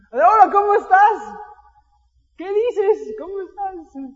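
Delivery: fake-sweet call to a friend who owes you; overly cheerful phone greeting. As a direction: pastor acting out a hopeful call to the brother. Mock phone call to the brother.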